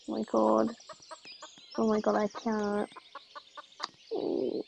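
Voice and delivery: ordinary talk, nothing marked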